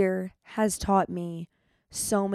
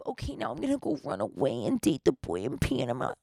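lower, softer mocking voice; mock silly voice; 7 semitones lower, 7 dB quieter than usual. Mocking the idea of running off to Panama, in a put-on voice. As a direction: parody a naive dreamer.

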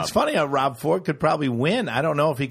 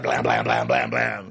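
gunshot imitation. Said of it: "that guy would have been like".

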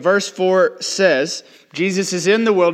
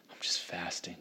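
whispered, much lower, pitiful sigh of a voice; mock weary whisper; 9 semitones lower, 17 dB quieter than usual. Mocking a dramatic college student, whispered and pitiful. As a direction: play the drama of suffering, barely voiced.

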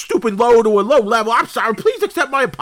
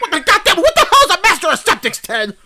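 high, loud fed-up demand; exasperated yell; 8 semitones higher, 3 dB louder than usual. Acted. Host yelling at his guest to explain himself.

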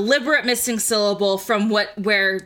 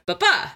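"ba, ba" with the second one jumping much higher; iambic beat; 5 semitones higher than usual. Shown as a demonstration. Host contrasting the iamb with a trochee, "ba, ba" stressed on the second. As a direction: lift the second "ba" sharply after a light first. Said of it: "instead of".

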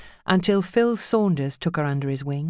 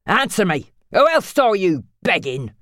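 raised, accusing interrogation; angry demand; 4 semitones higher, 6 dB louder than usual. Acted. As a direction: bark the question at her. Phil interrogating Judy angrily.

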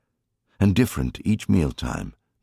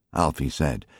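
usual speaking voice

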